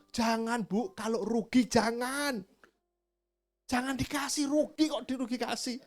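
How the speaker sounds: high, slow mock pleading; voicing the shopper. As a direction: play the buyer scolding the seller lightly. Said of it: "kamu bilang".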